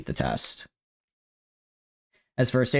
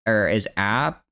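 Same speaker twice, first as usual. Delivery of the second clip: ordinary talk, nothing marked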